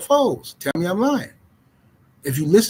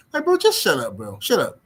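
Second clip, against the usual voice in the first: exasperated. Real, not acted. Exasperated at zesty question.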